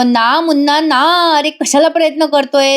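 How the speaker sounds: mock taunting.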